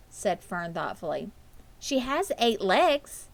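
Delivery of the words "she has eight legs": put-on slow, higher child voice; a hint of a matter-of-fact little girl; lightly voiced girl. A narrator's reading. Fern describing Charlotte to her mother, slowly.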